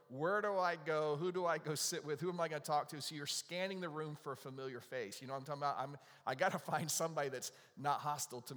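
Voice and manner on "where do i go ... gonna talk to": anxious inner panic. Acted. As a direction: play the lost guest scanning the room in panic. Acting a nervous guest's thoughts.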